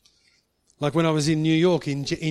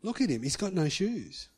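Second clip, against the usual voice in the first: soft pleading; 5 semitones higher, 5 dB quieter than usual. Retold or imitated. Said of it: "I said".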